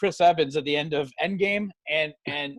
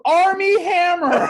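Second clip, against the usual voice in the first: laughing answer; 9 dB louder, slower than usual. Real, not acted. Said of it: "I never considered that".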